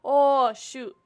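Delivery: normal talking voice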